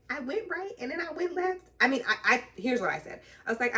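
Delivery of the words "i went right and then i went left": high, pleading retelling of her own excuse; mock whiny self. To the trainer, a high mock-helpless plea.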